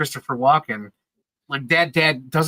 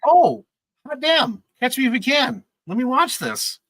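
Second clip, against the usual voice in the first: put-on excited kid; 10 semitones higher than usual. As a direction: jump up at finding the movie. Kid finds the movie on TV.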